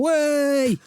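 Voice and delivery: high drawn-out crowd jeer; mock crowd jeer. The crowd mocking his fall, a long high jeer. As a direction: call it out like a jeering crowd. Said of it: "I hear 'em all".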